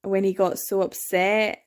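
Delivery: ordinary delivery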